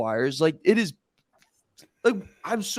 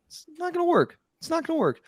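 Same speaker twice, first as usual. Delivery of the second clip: exasperated; 11 semitones higher than usual. Real, not acted. Dreading a doomed game plan.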